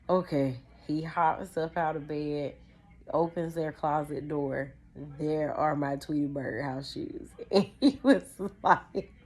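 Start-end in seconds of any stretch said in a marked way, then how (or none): none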